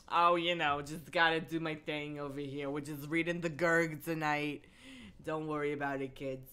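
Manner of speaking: put-on old Jersey grandma accent, low-key; casual New Jersey granny chatter. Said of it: "like, Jersey grandma voice".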